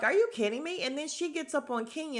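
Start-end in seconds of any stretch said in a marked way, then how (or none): 0.0-0.8 higher and louder, incredulous; replaying her own shocked reaction; mock disbelief. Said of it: "And I'm just like".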